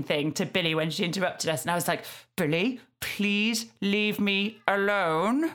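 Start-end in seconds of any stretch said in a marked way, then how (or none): none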